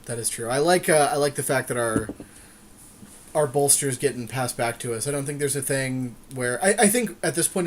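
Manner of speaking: ordinary delivery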